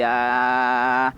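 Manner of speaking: long, drawn-out "aah"; falling cry. Acted. Tortoise drops from the treetop, long held falling cry. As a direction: sustain an acted cry through the fall.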